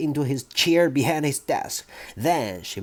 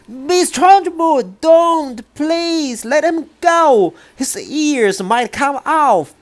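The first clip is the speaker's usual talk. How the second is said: much higher, louder pleading cry; 13 semitones higher, 6 dB louder, slower than usual. Acted. Teacher begs the headmistress to let boy go, high loud cry. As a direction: cry out from the back, begging her to stop. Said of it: "Miss Honey cried out".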